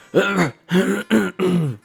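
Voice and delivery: long, loud throat clear. Real, not acted. Presenter stalling before graphs, clearing throat. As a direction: hack the throat clear noisily.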